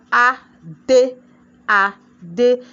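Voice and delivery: "adé" slowly beaten out in two separate beats; broken into syllables. Shown as a demonstration. Teacher demonstrating the syllable count, "adé" beaten out slowly. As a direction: split "adé" syllable by syllable, with clear gaps. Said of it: "When you beat it".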